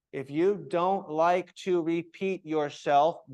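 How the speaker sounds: ordinary talk, nothing marked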